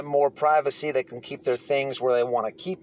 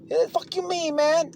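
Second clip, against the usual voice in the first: much higher, sneering challenge; imitated inmate snapping back; mock hostile retort; 13 semitones higher than usual. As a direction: throw it back at him, higher. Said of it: "retold confrontation".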